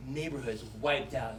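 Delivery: normal talking voice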